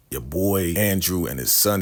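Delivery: ordinary talk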